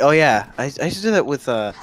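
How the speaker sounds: normal talking voice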